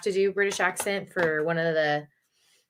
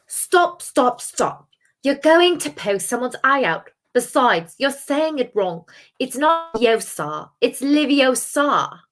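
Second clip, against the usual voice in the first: bossy British schoolgirl, high and loud; Hermione Granger; 7 semitones higher, 7 dB louder, slower than usual. Acted. Correcting someone's spell wrongly waved about, bossy British impression. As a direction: scold in a high, slow-drawn British accent. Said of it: "No more Hermione Granger for me".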